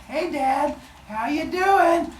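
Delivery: high, slow, sugary greeting; creepy sweet son. Acted. Cronus sneaks up on his dad, high and sickly sweet. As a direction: sweetly greet the father you're about to attack.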